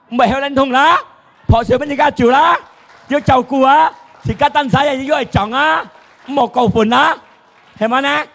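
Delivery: high, shouted rhetorical questions; impassioned preaching. Acted. Preacher challenging the congregation on daily worries, impassioned. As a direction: fire each question at the congregation, loud and high.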